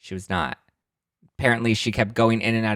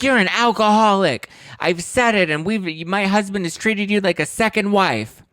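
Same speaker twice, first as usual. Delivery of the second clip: much higher, loud furious scream; screaming accusation; 11 semitones higher, 4 dB louder than usual. Retold or imitated. Kyle screams at her sister Kim, furious.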